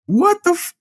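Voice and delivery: high-pitched, cut-off mock disbelief; incredulous squeal. Viewer baffled by creatures from the water, incredulous squeal.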